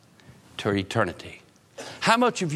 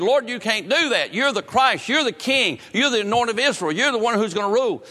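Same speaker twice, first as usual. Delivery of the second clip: high, pleading protest in Peter's voice; urgent high-pitched objection to the Lord; Peter's rebuke; 9 semitones higher, 3 dB louder than usual. Retold or imitated. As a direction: cry out like Peter refusing to accept it. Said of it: "Peter rebuked him".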